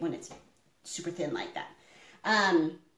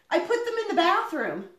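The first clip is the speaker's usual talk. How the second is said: quoting son; 9 semitones higher, 3 dB louder than usual. Retold or imitated. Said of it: "And Darian's like".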